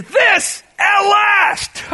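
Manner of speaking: high, loud, very slow cry of relief; relieved joy. Retold or imitated. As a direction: stretch This at last out in joyful relief. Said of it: "he says".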